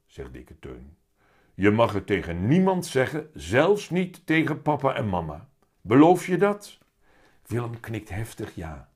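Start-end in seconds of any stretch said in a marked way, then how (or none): 1.5-6.8 loud, insistent cat, rising into the question; heavy-voiced talking cat. A narrator's reading. The cat swears Willem to secrecy, loud heavy voice. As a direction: press for a promise in the big cat voice. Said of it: "met zware stem".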